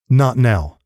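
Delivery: ordinary delivery, nothing marked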